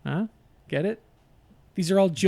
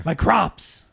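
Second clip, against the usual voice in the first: Al Pacino voice; 3 dB louder than usual. Shown as a demonstration. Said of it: "Al Pacino".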